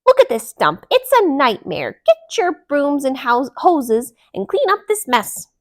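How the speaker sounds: loud high yelling; shouting. A narrator's reading. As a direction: stomp and yell door to door.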